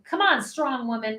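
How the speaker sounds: ordinary delivery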